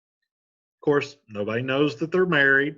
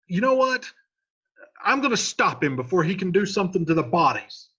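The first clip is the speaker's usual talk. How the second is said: much higher and louder, worked up; agitated Paris; 9 semitones higher, 5 dB louder than usual. Retold or imitated. Paris spotting Romeo at the tomb, alarmed and loud. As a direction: get frantic about the Montague. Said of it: "he's like".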